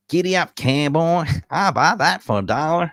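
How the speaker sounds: cowboy Texan impression, Southern accent, played for laughs. Man showing friends his favourite Southern Texan accent, hammed up. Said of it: "the one that I love to do is the Southern, uh, Texan".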